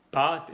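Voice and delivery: "pat" with a long, rounded back A; rounder A. Shown as a demonstration. Teacher showing the word for pasta, long round A in "pat". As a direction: stretch and round the A of "pat", loudly. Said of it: "A, pero más redonda".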